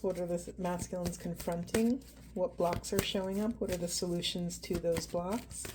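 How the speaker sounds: hushed, unhurried questions to Spirit; soft intuitive reading. Acted. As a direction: murmur it like a quiet reading. Quiet tarot card pull.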